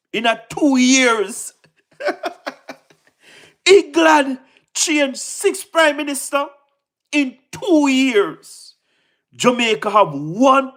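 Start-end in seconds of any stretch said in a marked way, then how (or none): 2.0-2.7 high giggle. Real, not acted. Laughing at England. 3.7-8.7 slowed and drawn out, mocking; slow teasing repeat; slow mocking emphasis. Real, not acted.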